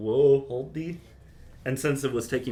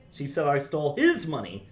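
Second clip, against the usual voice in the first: "his" pushed up; stress on HIS; 4 semitones higher, 5 dB louder than usual. Shown as a demonstration. Teacher shows stress to the composer, pushing "his". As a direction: dig into the word "his". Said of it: "depending on how you emphasize".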